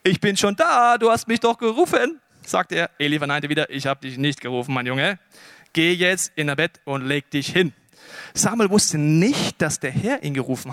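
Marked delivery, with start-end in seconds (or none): 0.0-2.2 high, louder pupil reporting in; eager, slightly puzzled young helper; eager boy. Acted. Boy runs to Eli again. 3.7-7.7 low, calm fatherly denial; gentle, low-voiced mentor; patient old teacher. Eli patiently sends boy back.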